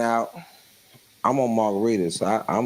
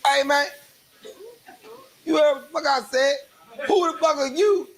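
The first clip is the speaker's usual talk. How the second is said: put-on high drunk yell; 12 semitones higher than usual. Drunk cousin stepping toward the officer, high mock-angry shout. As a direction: square up and yell at the cop.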